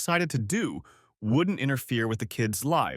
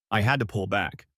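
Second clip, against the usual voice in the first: ordinary delivery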